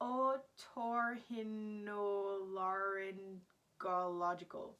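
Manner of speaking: very slow, syllable by syllable; sounding out. Shown as a demonstration. Vlogger tackling a hard word, laboriously sounding it out. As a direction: drag through the word piece by piece.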